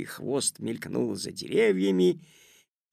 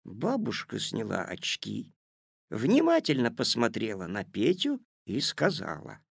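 plain reading voice

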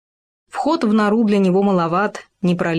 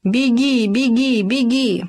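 urgent high yelling to flee; yelling run; 5 semitones higher, slower than usual. A narrator's reading. Friends fleeing the wolf, urging the hare to run. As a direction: scream at him to keep running. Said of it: "а наоборот вопили".

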